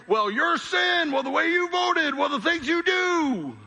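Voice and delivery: high-pitched, drawn-out mock rant; angry accusing. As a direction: play an angry finger-pointer, stretching the words. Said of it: "we start getting angry".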